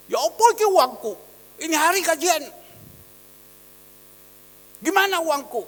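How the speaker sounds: loud, fast, rough demand for pay. Retold or imitated. Worker rudely demanding his pay from the pastor, loud and rough. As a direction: bark it like a worker demanding wages. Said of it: "Kasar kayak gitu".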